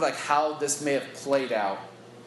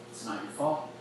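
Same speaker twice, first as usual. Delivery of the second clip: softly steady, a touch of tenderness; quiet and firm, looking him in the eye; tender, resolute; 5 semitones lower, 6 dB quieter than usual. Retold or imitated. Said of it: "full of tenderness".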